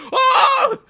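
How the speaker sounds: a sudden high cry of being hit; cartoonish high-pitched yelp on impact; pained yelp. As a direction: yelp as the car smashes in. Smiley getting hit by car.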